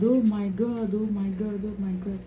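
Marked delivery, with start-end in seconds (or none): none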